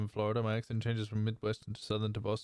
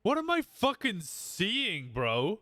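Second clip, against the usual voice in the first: high, slow, stunned outburst; startled disbelief; 10 semitones higher, much slower than usual. Real, not acted. Streamer reacting to something on screen, stunned disbelief. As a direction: drag it out in shock at what you see. Sudden shock at game screen.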